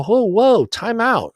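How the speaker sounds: high-pitched, retold halt; alarmed interruption. Winner to casino manager counting hundreds, alarmed. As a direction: cut in to stop it at once.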